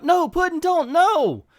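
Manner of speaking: Harley pleading in her sleep, panicked; panicked cry. Acted. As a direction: cry out in a nightmare. Harley wakes from a nightmare.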